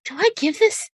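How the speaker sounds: high, slow anxious voice; high character voice. Acted. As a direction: pitch it high and worried.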